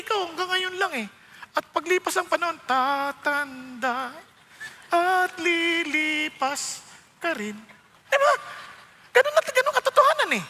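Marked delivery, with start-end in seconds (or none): 2.7-7.5 slow solemn. Real, not acted.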